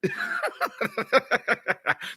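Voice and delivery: high-pitched giggling laugh. Real, not acted. Host laughing at own clue.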